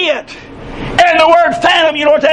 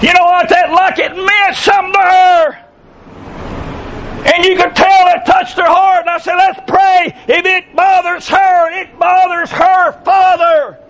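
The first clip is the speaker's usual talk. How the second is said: raised, fervent preaching voice; 4 semitones higher than usual. Real, not acted. Preacher rousing the congregation with fervent voice. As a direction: preach it out with full force.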